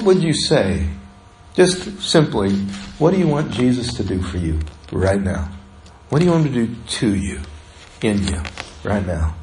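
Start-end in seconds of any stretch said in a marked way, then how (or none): 3.0-9.3 much softer and lower, almost to oneself; soft inward question. Shown as a demonstration. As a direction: ask it gently, as a private prayer. Question repeated softly, inward.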